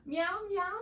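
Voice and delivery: lightly voiced cat meow; meowing rabbit. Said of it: "said the rabbit".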